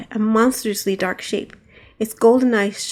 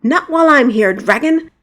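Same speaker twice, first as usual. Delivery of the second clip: loud heroic challenge; defiant shout; 5 semitones higher, 6 dB louder than usual. Acted. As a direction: roar it as you leap. Knight charges the dragon.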